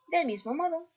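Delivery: ordinary talk, nothing marked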